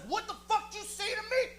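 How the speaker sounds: high-pitched mock-furious demand; enraged confrontation. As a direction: burst in spoiling for a fight. Enraged woman bursts in, retold.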